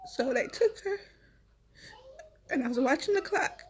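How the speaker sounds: the words break into a sob; crying. Real, not acted. Mother telling viewers the hospital took her baby, crying. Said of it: "I'm sorry I be crying".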